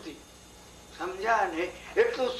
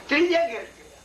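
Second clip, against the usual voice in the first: ordinary talk, nothing marked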